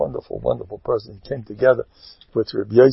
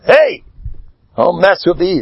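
leopard's challenge; 5 semitones higher than usual. Acted. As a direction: yell it like a leopard picking a fight.

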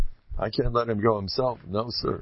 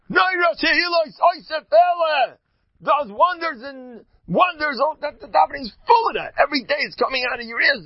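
high, loud exultant praise; impassioned high-pitched shouting; fervent exclaiming; 13 semitones higher, 5 dB louder than usual. Acted. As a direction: cry out the wonders, pitched way up. Acting out fervent, exultant prayer.

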